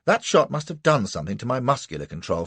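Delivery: ordinary delivery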